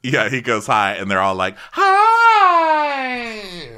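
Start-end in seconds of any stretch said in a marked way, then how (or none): 1.7-3.8 very high, loud, long-held squeal; hammed-up high squeal, stretched right out; squealed mock greeting. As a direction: shriek the greeting like a whole gushing group. All the women greeting the officer.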